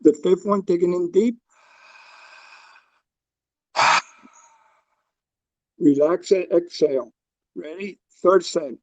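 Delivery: calm, paced breathing instructions; guided breathwork. Acted. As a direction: guide the group through each breath. Leads a breathing exercise.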